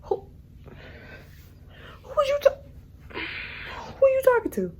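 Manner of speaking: high-pitched mimic of a confrontational man; mocking the man. Mimicking the man who started with her, high mock challenge.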